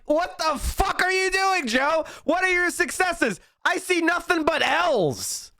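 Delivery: high, incredulous yelling at Joe; exasperated outburst. Real, not acted. Streamer berating Biden to his chat, high exasperated yelling. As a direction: demand answers in disbelief, voice climbing.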